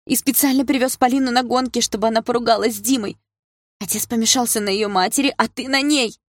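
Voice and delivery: high-pitched, heated reproach; angry outburst. A narrator's reading. Sister accuses brother of setting up the couple, high angry cries.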